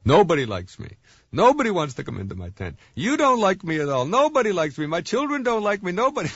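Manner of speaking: hammed-up guilt-trip wail from a market vendor; mock yelling. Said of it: "she started yelling at us".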